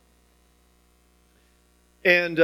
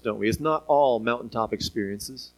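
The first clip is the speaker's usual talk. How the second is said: usual speaking voice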